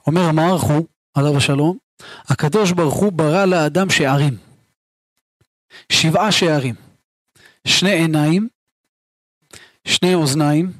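ordinary delivery

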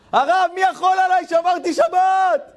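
high, drawn-out triumphant cry; joyful shout. Retold or imitated. Newly observant man calling the rabbi, elated shout. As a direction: yell it like you just won. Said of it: "ואני שומע צעקות".

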